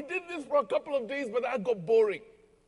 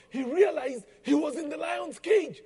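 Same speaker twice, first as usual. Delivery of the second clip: normal talking voice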